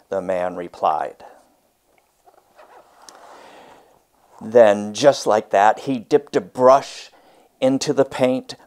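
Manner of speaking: lively read-aloud storytelling voice; story narration. A narrator's reading. Teacher reads a picture book to her class, expressive read-aloud.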